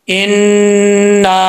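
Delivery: इन्ना with the n held long through the nose; nasal ghunna held. Shown as a demonstration. Teacher showing students the ghunna, held long. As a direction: hum the nn of इन्ना for one count. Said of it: "एक अलिफ की मिकदार गुन्ना करेंगे".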